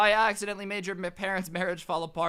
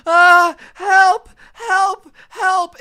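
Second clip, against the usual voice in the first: much higher, much louder shrieked help; hammed-up scream for help; mock screaming for help; 14 semitones higher, 17 dB louder, much slower than usual. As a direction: shriek help like a fake victim. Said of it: "she just starts screaming".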